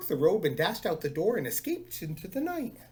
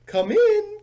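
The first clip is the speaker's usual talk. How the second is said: high, loud call from far off; faraway call; 11 dB louder than usual. Acted. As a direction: shout it from the back of the house. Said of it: "called a faraway voice".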